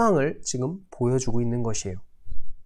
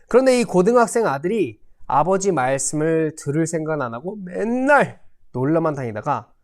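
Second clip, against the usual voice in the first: ordinary talk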